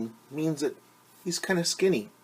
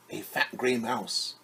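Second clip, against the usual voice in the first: ordinary delivery, nothing marked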